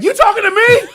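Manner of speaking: loud, high hammed-up challenge; tough-guy deer voice. Host voicing the deer taunting his dog. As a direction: play the deer spoiling for a fight. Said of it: "he was like".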